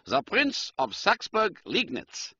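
high, slow German count; measured German-accented formality. A narrator's reading. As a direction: announce a title grandly in a German accent. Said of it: "He spoke with a German accent".

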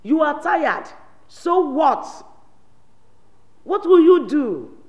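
defiant shouting. Acted. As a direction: dare him to act, rising each line. Mother defies son.

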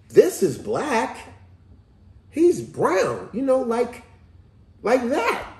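high, insistent mock-child explanation; little boy explaining. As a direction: imitate a kid pointing out the obvious. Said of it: "and the little boy go".

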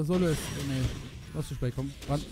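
ordinary talk, nothing marked

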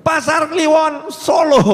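a drawn-out shout of the town name, slow and loud; called out answer. Retold or imitated. Preacher voicing the crowd's reply, shouted slowly. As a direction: shout the place back like a crowd calling out. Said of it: "muncul gitu ya".

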